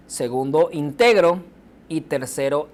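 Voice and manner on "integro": íntegro said as in-TE-gro, weight in the middle; stress on middle syllable. Shown as a demonstration. Teacher reading the second example, stressing the middle of íntegro. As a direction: lean on TE in íntegro. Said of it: "la lectura nos dará la pauta".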